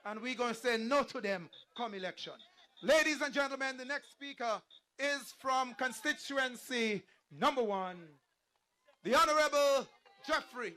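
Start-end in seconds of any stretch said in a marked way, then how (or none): 2.8-10.7 big announcer voice building to the name; rally announcer. Acted. MC presenting the next constituency speaker to the rally, rally announcer. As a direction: introduce the speaker with a loud, ringing finish.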